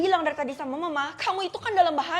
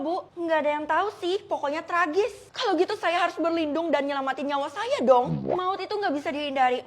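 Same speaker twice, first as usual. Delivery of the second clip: ordinary delivery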